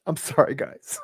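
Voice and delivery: choked, quiet, voice nearly gone; real tearful apology. Real, not acted. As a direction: apologize softly through real tears. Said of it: "I didn't mean to, like, start crying".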